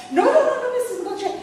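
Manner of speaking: high posh flurry of denial; put-on posh, starting high and loud; posh phone voice. Receptionist denying she knows the caller, high and posh. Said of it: "I decided I'd be rather posh".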